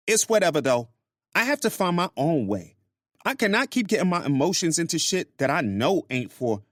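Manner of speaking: high-pitched woman venting to a friend; Grace's voice. Acted.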